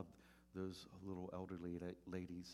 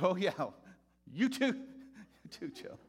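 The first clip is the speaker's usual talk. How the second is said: mock cheerful comeback; 12 semitones higher, 12 dB louder than usual. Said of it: "I'm like".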